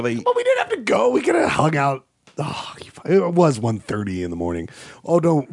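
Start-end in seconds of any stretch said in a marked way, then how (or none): 0.0-2.0 mock shout. Real, not acted.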